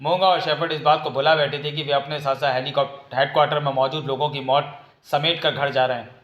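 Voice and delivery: plain reading voice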